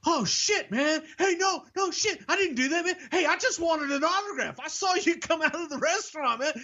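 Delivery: very high, squeaky voice of a nervous fan; pleading in a high-pitched squeal; panicky stranger. Retold or imitated. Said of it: "he goes".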